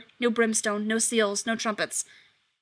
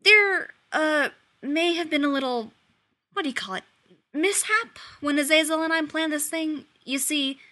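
nervous; 6 semitones higher than usual. Acted. Demon nervously explaining mishap.

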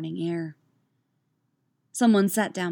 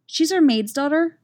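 higher and louder, speaking up for a friend; a hint of an insistent girl; lightly voiced girl; 9 semitones higher, 5 dB louder than usual. A narrator's reading. Portia introduces her friend to the teacher, a hint of insistence.

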